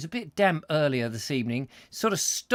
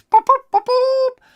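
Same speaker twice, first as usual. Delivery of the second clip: high, loud booped trumpet fanfare; playful sung-out boops, high and loud; mock fanfare; 12 dB louder, slower than usual. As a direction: toot a little prize fanfare with your voice. Mock fanfare for first in door.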